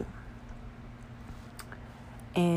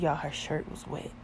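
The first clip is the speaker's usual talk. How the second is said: usual speaking voice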